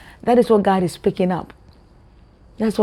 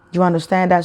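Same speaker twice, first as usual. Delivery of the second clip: usual speaking voice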